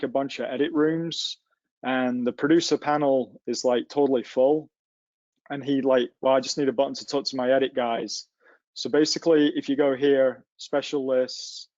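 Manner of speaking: usual speaking voice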